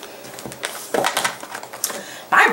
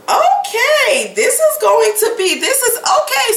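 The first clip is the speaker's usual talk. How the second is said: much higher, excited reaction; excited high okay. Real, not acted. Reacting to the card.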